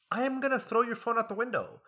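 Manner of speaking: high-pitched mock outburst, building to a loud finish; mock exasperated tirade. Fed-up student to classmate watching videos mid-quiz, mock exasperated outburst.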